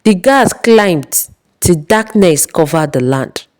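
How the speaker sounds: plain reading voice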